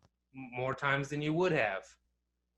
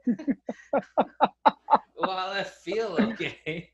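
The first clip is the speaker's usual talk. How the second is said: laughing; 7 semitones higher, 9 dB louder than usual. Real, not acted. Laughing at friend's joke.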